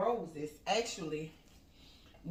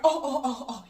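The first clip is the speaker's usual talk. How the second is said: re-enacted high, loud string of ohs; overwhelmed; 5 semitones higher, 4 dB louder than usual. Mom re-enacting getting gifts from daughter, overwhelmed ohs. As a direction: gush wordlessly, higher and louder, as if overcome.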